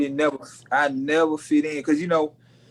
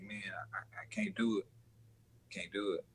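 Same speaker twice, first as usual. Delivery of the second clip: whispered refusal; 5 semitones lower, 16 dB quieter than usual. Retold or imitated. Retelling telling his boy no.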